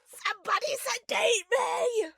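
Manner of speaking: high, loud hammed-up scream; mock hysteria. Fan overwhelmed by the ending, mock hysterical scream. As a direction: shriek as if you need calming down.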